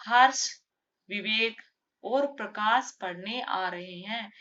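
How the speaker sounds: हर्ष, break, विवेक, break, और प्रकाश onwards; pause between names. Shown as a demonstration. Teacher showing commas in a list, pause after Harsh and Vivek. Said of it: "हर्ष और विवेक के बीच में क्या है".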